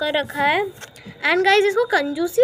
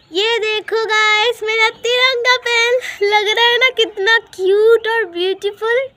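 higher, delighted gushing over the pen; excited showing off; 7 semitones higher, 6 dB louder than usual. Real, not acted. Showing viewers her tricolour pen, excited and high. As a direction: beam about how cute it looks.

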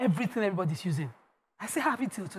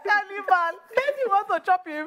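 high, loud mock yell; hammed-up husband yelling; retelling her husband's shocked shout; retold shout; 9 semitones higher, 3 dB louder than usual. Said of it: "My husband shouted".

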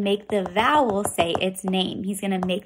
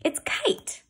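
excited reveal; 10 semitones higher than usual. Shown as a demonstration. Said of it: "What's the new word?".

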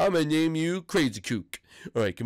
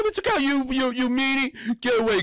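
ordinary talk

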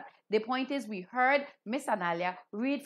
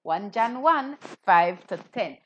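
loud call and response; 9 dB louder than usual. Shown as a demonstration. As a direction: call it out loud for the children to repeat. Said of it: "Can you say that?".

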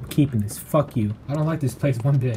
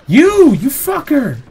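high shout, loud on the first word; accusing yell; 9 semitones higher, 5 dB louder, slower than usual. Real, not acted. Player finds who was throwing things, accusing yell. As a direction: explode at the culprit.

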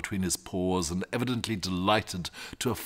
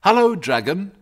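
plain reading voice

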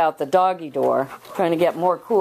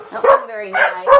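much higher, sing-song scolding of the dog; 13 semitones higher, 3 dB louder than usual. Real, not acted. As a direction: raise the voice up high to chide.

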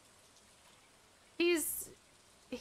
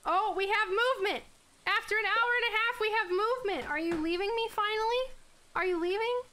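excited; 8 semitones higher, 5 dB louder than usual. Real, not acted. Dog finally moves, excited.

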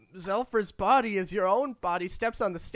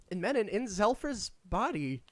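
ordinary delivery

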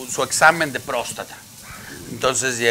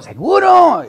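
much higher, louder sure answer; emphatic reply; 10 dB louder, slower than usual. Retold or imitated. Magician assures the surfer, high and loud. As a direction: fire it back loud and high.

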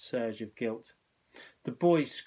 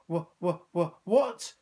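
breathless, stammering, rising higher; panting stammer; 5 semitones higher, 5 dB louder than usual. A narrator's reading. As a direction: gasp out the words in fright. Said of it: "he panted".

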